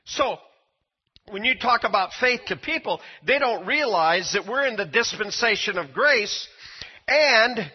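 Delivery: fiery sermon voice to the church; fervent preaching. Acted. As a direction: drive the point home to the congregation. Preaching builds to full force.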